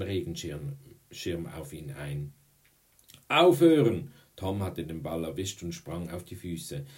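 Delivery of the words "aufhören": high, loud protesting yell; desperate shout. A narrator's reading. Boy under a hail of blows, desperate shout. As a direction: yell to stop the beating.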